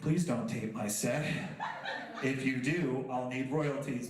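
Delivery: low, slow deadpan warning; mock stern warning. Comic catches someone taping his set, mock stern warning. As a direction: drop deep and warn them drily.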